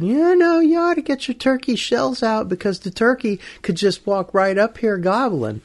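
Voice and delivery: high-pitched, teasing voice of the Lord; Lord's playful voice. Acted. Lord tipping off the hunter the night before, playful high voice. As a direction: start very high and ease down, playfully. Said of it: "it was the voice of the Lord".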